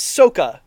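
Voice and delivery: "Sokka" with a long O, SOH-ka, loud; mispronounced name. Shown as a demonstration. Host mocking the film's "Sokka" as SOH-ka, loud. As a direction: hit "Sokka" slowly as SOH-ka. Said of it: "mispronounce basically everybody important's name".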